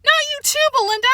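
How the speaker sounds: high despairing wail for the dog; horrified cry. Acted. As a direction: cry it out, heartbroken. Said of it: "she cried out".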